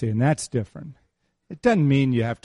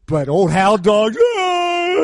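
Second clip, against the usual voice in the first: mock hound dog howl; 8 semitones higher, 7 dB louder, much slower than usual. Self as crying hound dog.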